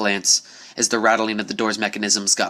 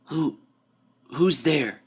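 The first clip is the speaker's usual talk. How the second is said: higher, faltering scared call; weak call; 6 semitones higher, much slower than usual. A narrator's reading. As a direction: call out weakly, trying to be brave. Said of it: "the Pegasus called out weakly".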